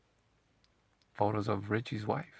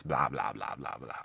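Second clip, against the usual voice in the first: dismissive blah; 7 semitones lower than usual. Real, not acted. Dismissive list trailing off.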